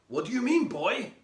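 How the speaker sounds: lightly voiced grandad with an Indian accent mixed with Glaswegian. Ghost grandad answering the boy, light Indian-Glaswegian accent. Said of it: "in an Indian accent fused with Glaswegian".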